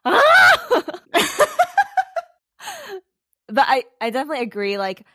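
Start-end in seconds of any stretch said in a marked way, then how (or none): 0.1-0.8 laughing shout. Real, not acted. Laughing outburst mid-chat. 0.9-2.2 high laugh. Real, not acted. Laughs after outburst.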